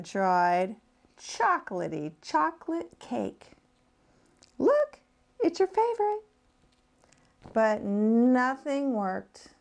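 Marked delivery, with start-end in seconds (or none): 4.6-6.2 very high and bright offering; enticing mother. Acted. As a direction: dangle a treat excitedly. Mother offering cake to tempt.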